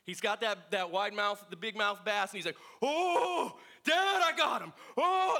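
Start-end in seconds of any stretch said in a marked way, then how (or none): 2.8-5.4 put-on high, loud excited kid; all excited. Son shouting to his dad about his first bass, high and thrilled. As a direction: squeal it like a thrilled little boy. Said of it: "like, all excited".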